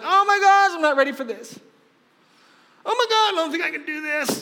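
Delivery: high-pitched, hammed-up dread; mock panic. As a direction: wail it like someone not ready. Acts out own panicked protest to God.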